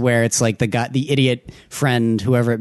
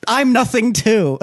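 high, slow, giggling self-pity; laughing mock despair; 10 semitones higher, slower than usual. Laughing along with a friend's sad joke, slow and high. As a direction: laugh while claiming to be nothing.

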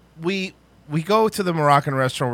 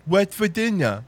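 higher-pitched slurred janitor imitation; the janitor's voice; 4 semitones higher than usual. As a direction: answer in the janitor's odd, slurred voice. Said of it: "you be the janitor".

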